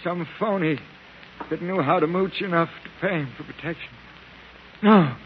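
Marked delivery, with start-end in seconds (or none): 4.8-5.1 terrified pleading. Acted. Delirious beggar cries out at remembered attackers. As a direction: beg in panic not to be struck.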